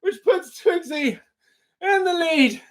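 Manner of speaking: high-pitched squealing laugh, near tears; laughing so hard he can barely speak; helpless laughter. Real, not acted. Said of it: "I can't do it".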